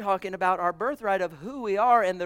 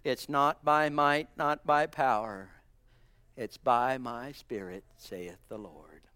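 solemn scripture; 4 semitones lower, slower than usual. Acted. Quoting scripture, slow and soft.

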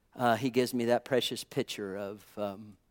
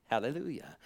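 soft hallelujah; 10 dB quieter than usual. Real, not acted. Pastor closing a quiet point, soft murmured praise. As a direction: let Hallelujah fall away quietly.

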